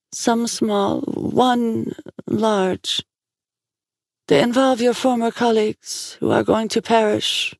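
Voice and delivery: high, slow, even prophetic voice; oracle in trance. A narrator's reading.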